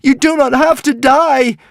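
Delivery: high, full-force pleading shout; desperate yell. A narrator's reading. Hiccup begs the dying dragon to live, desperate yell. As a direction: yell it slowly, begging him to live. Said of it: "yelled Hiccup".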